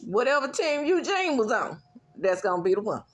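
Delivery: loud excited insistence. Real, not acted.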